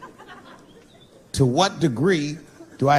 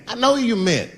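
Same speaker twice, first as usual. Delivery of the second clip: ordinary talk, nothing marked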